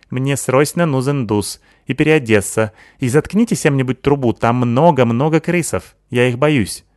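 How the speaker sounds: put-on Sykil's accented voice. Sykil praising Natasha, lisping accented girl voice.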